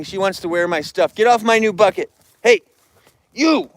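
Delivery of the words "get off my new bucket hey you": loud, higher yell to drive off a bear; shouting at a bear. Real, not acted. Man yells at a bear sitting on his new bucket. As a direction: bark it out to scare the bear away.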